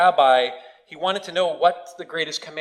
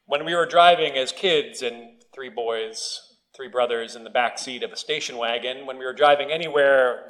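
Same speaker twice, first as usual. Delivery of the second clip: ordinary talk, nothing marked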